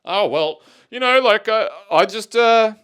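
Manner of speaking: high, loud mock excuse; imitated driver's quip. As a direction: put on a protesting driver making excuses. Imitating drivers' quips in battle reports.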